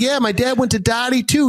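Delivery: excited thick Boston; Boston accent. Retold or imitated. Telling driver about dad, excited Boston accent. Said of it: "having a Boston moment".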